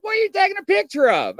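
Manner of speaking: high, quick mimicked questioning; imitated nosy question. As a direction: fire off the companion's question high and fast. Retells companion questioning him.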